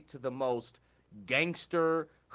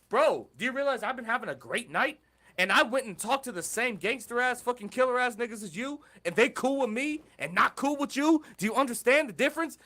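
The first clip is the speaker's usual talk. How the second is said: heated retold lecture; 6 semitones higher, 3 dB louder than usual. Retold lecture to his friend.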